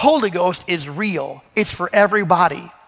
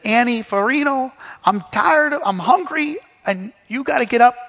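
a hint of an exasperated grandfather, pitched higher; lightly voiced old man demanding his wife get up; mock demanding grandpa; 6 semitones higher than usual. Grandpa telling depressed grandmother to get up, mock exasperated. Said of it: "he came in and said".

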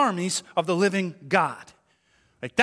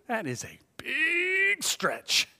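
"big" stretched very long and high; drawn-out emphasis; 8 semitones higher, 3 dB quieter, much slower than usual. Retold or imitated. Listener judging pastor's theory, "big" hugely drawn out. Drawn-out mock verdict on claim.

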